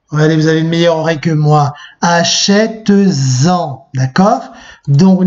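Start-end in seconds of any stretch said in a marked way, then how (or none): 2.0-3.9 Achète-en drawn out very slowly, the t linking with a z sound into en; slow teacher demo of the z liaison in Achète-en; showing the liaison. Shown as a demonstration. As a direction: stretch Achète-en to make the liaison heard. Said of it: "Vous entendez la liaison?".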